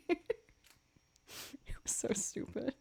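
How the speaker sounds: slow whispered aside. Real, not acted. Streamer muttering to chat, whispered.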